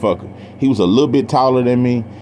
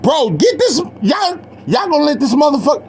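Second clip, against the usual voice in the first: frantic plea, pitched much higher; panicked plea. Retold or imitated. A boy chased with a pipe begs the brother for help, high frantic plea. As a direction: beg for help while running from the pipe. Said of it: "I'm looking at her little brother like".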